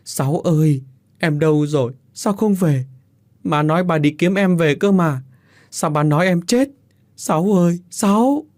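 high, tearful calling; wailing. A narrator's reading. Older sisters calling for their dead brother, wailing. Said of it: "khóc lớn tiếng".

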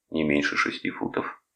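ordinary delivery

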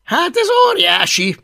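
plain reading voice

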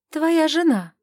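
high, hammed-up pretend offence; mock offended. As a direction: play at being hurt, teasing. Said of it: "деланно оскорбилась".

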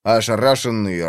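usual speaking voice

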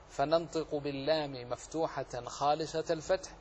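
ordinary talk, nothing marked

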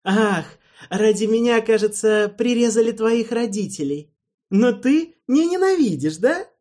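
high loud jeering; mocking taunt. A narrator's reading. Alvin taunts Erald about his murdered parents, jeering. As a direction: goad him with scorn.

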